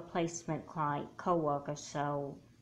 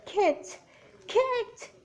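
very high screamed name; anguished scream; 6 semitones higher, 3 dB louder, much slower than usual. Acted. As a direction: scream her name in panic. Screaming dead sister's name.

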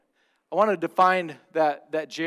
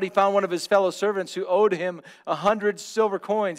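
normal talking voice

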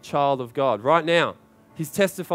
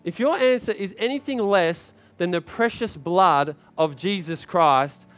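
ordinary talk, nothing marked

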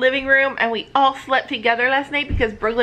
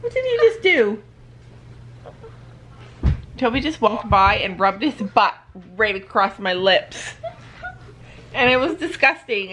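ordinary talk

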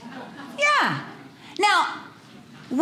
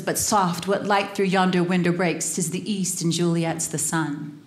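romantic Romeo; 6 semitones lower than usual. Acted. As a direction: breathe it up to the balcony. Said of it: "he says".

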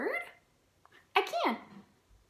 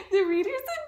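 much higher, loud, giggling through it; laughing squeal; 6 dB louder than usual. Acted. Piggy cracking up that the reader said banana, laughing high.

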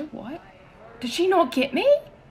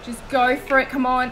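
ordinary delivery

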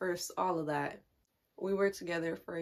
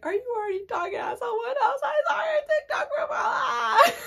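hammed-up high, fast crying wail; mock sobbing; 6 dB louder than usual. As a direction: imitate yourself wailing hysterically into a call. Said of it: "crying, sobbing".

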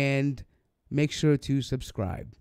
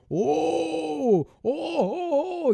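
high squealing oh oh oh, hammed up; mocking excited squeal; 11 semitones higher, much slower than usual. Mocking the scholar's glee at the curses, squealing oh. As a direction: mimic his giddy delight in a squeaky pitch. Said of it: "getting excited".

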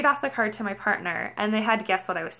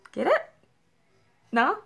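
usual speaking voice

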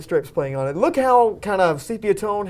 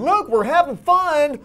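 high, mock-cheerful sales pitch; eager studio people; 8 semitones higher than usual. Warner Brothers people pitching the recut, mock-cheerful. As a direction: insist on the fun in a higher, hammed-up voice. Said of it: "people at Warner Brothers saying".

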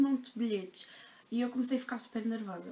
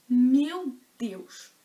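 exasperated outburst; 8 dB louder than usual. Real, not acted. Holding back tears at no tickets.

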